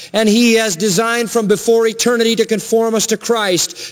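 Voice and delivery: high-pitched, insistent preacher's delivery; impassioned preaching. Acted. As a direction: drive each phrase home from the pulpit, pitch held high. Preaches at full force.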